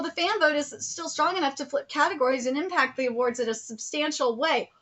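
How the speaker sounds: ordinary talk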